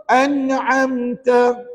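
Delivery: AN'AMTA slowly with a clear, un-nasalised n; izhar. Shown as a demonstration. Teacher drills the no-hum rule, AN'AMTA with clear n. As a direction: chant AN'AMTA, n sounded clearly without humming. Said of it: "kita kena baca dengan iz-, izhar".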